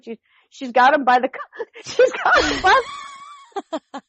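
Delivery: high, squealing laughter through the words; breaking up laughing. Real, not acted. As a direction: crack up until the sentence collapses. Cracks up retelling the story.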